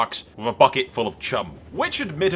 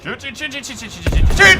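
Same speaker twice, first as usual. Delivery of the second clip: panicked; 12 semitones higher, 7 dB louder than usual. Real, not acted. Near crash while flying.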